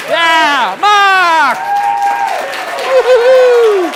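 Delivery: high, loud whooping cheer, drawn out slowly; big whooping send-off shout; cheering yell. Real, not acted. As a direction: whoop for him as he leaves. Cheering the volunteer off stage.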